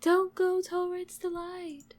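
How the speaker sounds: slow, high, drawn-out distant call; faraway voice. Acted. Voice from the light warning someone away, faraway and eerie. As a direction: stretch it out like a voice from afar. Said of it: "you hear a voice from far away".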